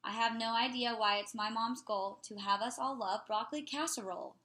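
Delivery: ordinary delivery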